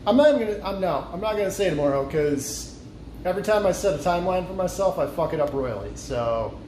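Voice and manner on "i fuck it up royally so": ordinary talk, nothing marked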